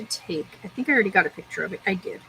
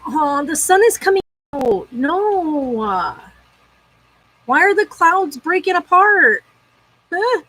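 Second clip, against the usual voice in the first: higher, louder indignant protest; dismayed outcry; 6 semitones higher, 5 dB louder, slower than usual. Real, not acted. Sun comes out and spoils her plans, higher dismayed outcry. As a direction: protest at the sky.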